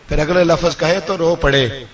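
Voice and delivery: usual speaking voice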